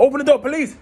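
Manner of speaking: high, urgent mock police yell; police shout. Officer yelling to open car.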